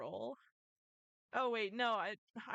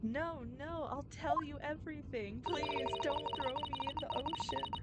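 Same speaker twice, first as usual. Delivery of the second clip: higher-pitched, frantic begging; panicked pleading; 4 semitones higher than usual. Acted. Captive begging not to be thrown in the ocean, panicked pleading. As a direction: plead desperately for your life in a raised pitch. Game character begs for his life.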